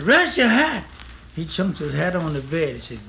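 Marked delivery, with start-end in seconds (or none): 0.0-0.9 bossy order. Retold or imitated. Girl tells him to remove it.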